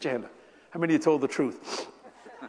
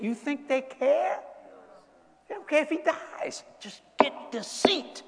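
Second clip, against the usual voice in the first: high, slow, callous politician voice; mock politician; 11 semitones higher, slower than usual. Preacher voicing politicians who only want the seat, mock callous. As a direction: sneer it like a heartless senator.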